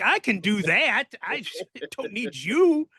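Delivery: high, drawn-out retold protest; indignant brush-off. Wrestler turning down help in a fight, indignant. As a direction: stretch it out in mock indignation, pitch up.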